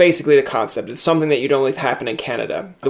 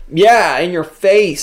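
louder, slow mock gloat, higher; gloating taunt; 5 semitones higher, 6 dB louder, slower than usual. Soccer opponent gloating over a flattened player, mock taunt. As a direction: rub it in, drawn out and loud.

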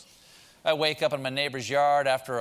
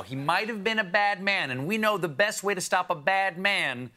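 ordinary delivery

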